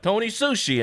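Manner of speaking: high, announcing the name in a put-on voice; mock announcer; billboard chef. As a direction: say it like the guy on the sign.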